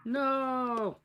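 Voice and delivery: shocked no. Real, not acted. Shocked at the twist.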